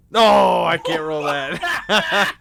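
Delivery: amused outburst at a bad roll; laughing protest. Real, not acted. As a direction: shout it out through laughter. Player laughs at own bad roll.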